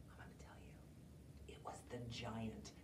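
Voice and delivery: hushed secret reveal; storyteller whispering to the child; spooky whisper. A narrator's reading. Dramatic reveal to listener.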